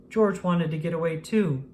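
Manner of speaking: usual speaking voice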